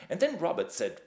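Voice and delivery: usual speaking voice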